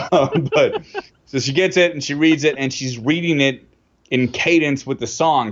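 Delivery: normal talking voice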